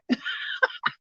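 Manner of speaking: airless high giggle; real wheezy giggle; breathy laugh. Real, not acted. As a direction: giggle without voice. Laughs about needing ointment.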